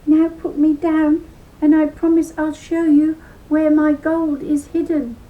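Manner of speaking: high, loud little-creature voice; leprechaun voice. Acted. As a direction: bargain like a tiny squeaky fellow. Leprechaun bargaining.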